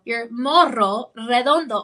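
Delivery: "morro redondo" with rolled Rs, slowly. Shown as a demonstration. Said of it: "I have to roll the R twice".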